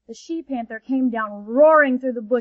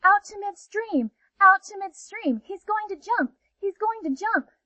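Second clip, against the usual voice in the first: much higher, urgent alarm calls; excited shouting; 9 semitones higher than usual. Acted. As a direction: rays warning each other in a panic. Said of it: "began to shout to one another".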